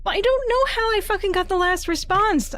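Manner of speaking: high, loud exasperated cry; high and loud, fed up with being stuck; frustrated outburst. Real, not acted. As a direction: blurt out real frustration at the puzzle.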